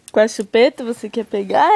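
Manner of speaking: usual speaking voice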